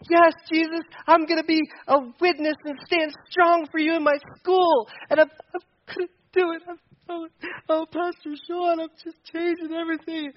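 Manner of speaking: high, gushing mock teenager vow; gushing camp kid. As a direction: gush like a fired-up kid at summer camp. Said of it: "Then kids would be like".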